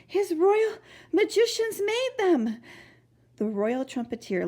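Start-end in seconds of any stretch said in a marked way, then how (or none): 0.0-2.6 put-on urgent boy. Boy explains breathlessly.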